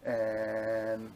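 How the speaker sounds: ordinary delivery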